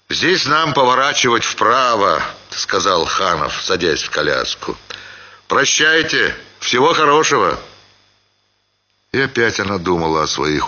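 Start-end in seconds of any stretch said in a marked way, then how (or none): 0.1-2.2 барин, заметно выше и громче; деловито распоряжается кучером; голос Ханова. A narrator's reading. Ханов говорит Семёну. 5.5-7.6 барин, заметно выше и громче; деловито распоряжается кучером; голос Ханова. A narrator's reading. Ханов прощается с учительницей.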